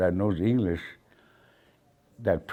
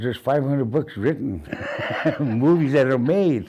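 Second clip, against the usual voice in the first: higher, louder comeback; emphatic retold retort; 7 semitones higher, 5 dB louder than usual. Grandson retelling his answer to critics, emphatic and raised. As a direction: pitch it up and push it out as a rebuttal.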